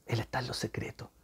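soft hushed whisper. Real, not acted. Preacher assuring listener, whispering God is in secret. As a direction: breathe it out as a secret.

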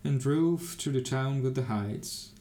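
ordinary delivery

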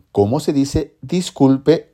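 ordinary talk, nothing marked

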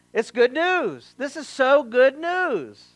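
slow, drawn-out, savoring each word; preacher savoring the news; relish good news. Acted. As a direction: lean on good news, slowly.

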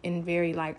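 usual speaking voice

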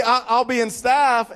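ordinary talk, nothing marked

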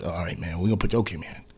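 very soft, low go-ahead; hushed mock commissioner. Commissioner quietly waving the player through, hushed. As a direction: barely voice the deal. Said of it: "let you like".

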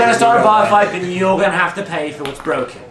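scolding barkeep. Acted. Barkeep warns about damages.

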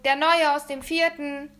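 loud, high yell; boy yelling back to his mother in the kitchen. Acted. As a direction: bellow it down the hall. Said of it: "brüllte ich zurück".

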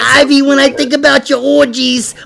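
high-pitched Italian guy from New York; Italian-American mook. Acted. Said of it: "That's a mook".

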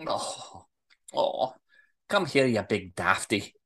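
low, soft melting affection; tender, low teasing endearment; sweetly. Acted. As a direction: soften right down into a fond invitation. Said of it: "suddenly smiles sweetly".

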